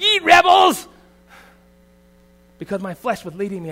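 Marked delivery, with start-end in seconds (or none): 0.0-0.9 high, loud hammed-up shout; mock yell. Father shouting at his son, hammed-up angry yell. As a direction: bellow it like an angry prophet. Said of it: "I looked at him and said".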